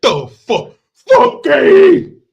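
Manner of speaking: very high, loud comic wail; mock yell. Comic goofing on the film line, high mock yell. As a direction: screech it hammed-up.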